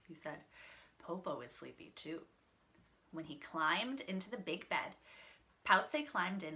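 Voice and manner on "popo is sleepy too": drowsy grandma voice, quiet; sleepy, softer wolf. Acted. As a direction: fake a sleepy murmur. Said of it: "He yawned".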